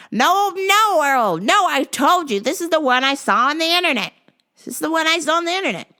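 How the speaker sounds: high, loud stubborn nag; high old wife voice. Retold or imitated. Maggie arguing with her husband, high stubborn insisting.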